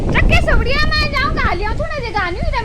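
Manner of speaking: high, loud outdoor yell; real shout. Real, not acted. Vlogger shouting outdoors mid-chore, high loud shout. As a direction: shout it high over the wind.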